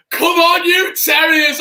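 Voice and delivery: loud, high rallying cry, easing slightly; real elated outburst; jubilant fan shout. Real, not acted. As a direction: shout it out to the team. Fan cheering his team's referee call.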